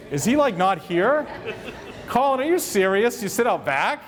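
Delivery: high, laughing teasing question; laughing disbelief. Real, not acted. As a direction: laugh through the words, calling him out. Teasing missing colleague Colin.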